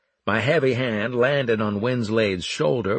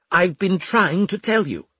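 higher, louder contained exasperation; clenched teeth; 10 semitones higher, 5 dB louder than usual. A narrator's reading. Officer to the centurion who was joking, through clenched teeth. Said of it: "he said between clenched teeth".

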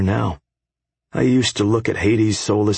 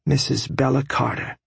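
proud wife's voice; 6 semitones higher than usual. A narrator's reading. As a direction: say her new name with pride. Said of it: "she said".

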